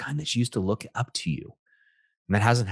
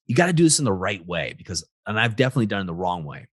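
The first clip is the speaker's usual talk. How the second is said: usual speaking voice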